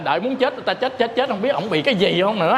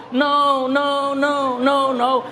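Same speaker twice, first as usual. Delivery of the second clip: repeated mock no; 5 semitones higher, much slower than usual. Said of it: "thầy cũng nói tiếng Anh".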